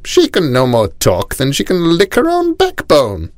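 high cackling scorn; cackling aunt. A narrator's reading. Rani's aunt scoffing at Cinnamon, high cackling voice. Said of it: "cackled the Rani's aunt".

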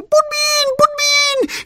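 very high squeaky plea; tiny pleading voice. Acted. Little cherry begging the girl to play, tiny squeak. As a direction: beg like a tiny hero.

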